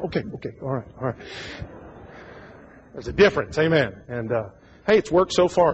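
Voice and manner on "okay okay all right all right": backing down. Retold or imitated. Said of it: "husband backs down".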